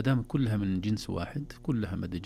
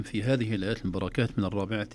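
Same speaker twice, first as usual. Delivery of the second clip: ordinary delivery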